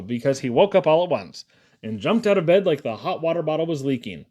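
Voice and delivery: ordinary delivery, nothing marked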